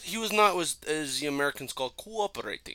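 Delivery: halting mock Russian soldier accent; Nova, Russian accent. Dog explaining the bird's fate, mock Russian accent. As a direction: grope for English words in a Russian accent. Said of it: "like a fucking Russian soldier".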